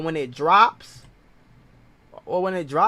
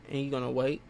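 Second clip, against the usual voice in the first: normal talking voice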